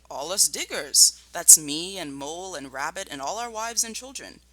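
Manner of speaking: put-on lively read-aloud voice for children; story narration. A narrator's reading. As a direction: read the chapter like a teacher at storytime. Teacher reads chapter aloud.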